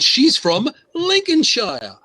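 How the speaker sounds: high, slow, drawn-out flourish; theatrical slow announcement of the place; hammed-up reveal. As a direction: swoop up and stretch LINCOLNSHIRE. Playfully hyping the town's name.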